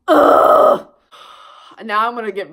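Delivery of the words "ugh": disgusted groan. Real, not acted. Disgust at the story.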